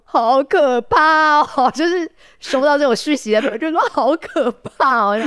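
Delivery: high, slow, half-laughing mock fright; laughing mock horror. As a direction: play up the friends' fake shock with a laugh.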